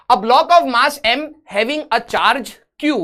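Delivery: very slow, word-by-word reading; slow dictation. Real, not acted. Teacher reading a physics question to students, slow dictation. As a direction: read it out slowly so they can copy it down. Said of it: "reading question aloud slowly".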